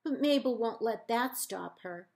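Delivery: ordinary delivery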